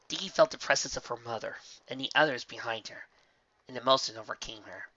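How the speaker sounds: ordinary delivery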